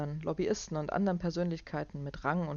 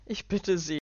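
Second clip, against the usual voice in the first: loud, higher, laughing dismissal; barking laugh; 3 semitones higher, 6 dB louder than usual. Acted. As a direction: laugh it off heartily. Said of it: "Clark lachte bellend".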